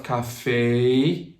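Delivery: CAFÉ ending in a drawn-out AY-ee; anglicized "café", last vowel sliding upward to EE; gliding English vowel. Shown as a demonstration. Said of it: "you change the mouth".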